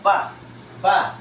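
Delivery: ba said as a clear loud syllable; sounding out ba. Shown as a demonstration. Teacher sounding out ba for pupils. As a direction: model the syllable ba. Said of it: "Ang pag-pronounce ay".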